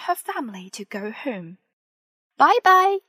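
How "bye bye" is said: high, loud farewell call. A narrator's reading. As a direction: call goodbye across a distance. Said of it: "they call".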